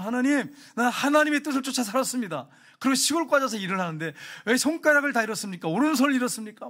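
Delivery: high-pitched mock protest to God; aggrieved outcry. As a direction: imitate a believer bitterly arguing with God. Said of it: "막 이렇게 하면서".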